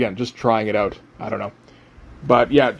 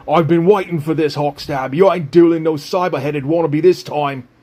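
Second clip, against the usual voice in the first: put-on horrible Cockney accent; 3 semitones higher than usual. Villain Knife taunting Hawkstab before a duel, in a hammed-up Cockney accent. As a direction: sneer it in a bad Cockney accent, like Kano. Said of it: "reworked all of his dialogue to do a horrible Cockney accent".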